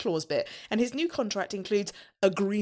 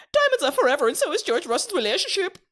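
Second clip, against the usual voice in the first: high, loud sing-song headline; announcer headline; 6 semitones higher, 5 dB louder than usual. Acted. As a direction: belt out the segment title up high. Reads segment headline as announcer.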